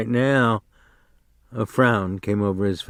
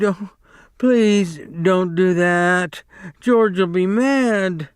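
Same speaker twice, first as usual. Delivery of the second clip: slow, higher pleading; begging; 8 semitones higher, slower than usual. A narrator's reading. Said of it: "he begged".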